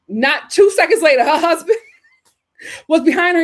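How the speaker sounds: laughing while talking. Real, not acted. Laughing while telling the story.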